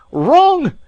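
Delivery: explosive high furious yell of the word; furious shout. A narrator's reading. Alan shouting at the maid, furious. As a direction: Alan bursts out in fury. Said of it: "with a kind of fury".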